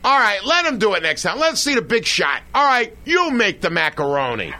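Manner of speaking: dad teasing his wife about the big shot; imitating father. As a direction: play the father egging the kid on. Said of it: "my father would say".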